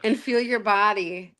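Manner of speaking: slow dreamy meditation voice; guided meditation. Acted. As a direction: draw the words out gently. Leads a guided visualisation.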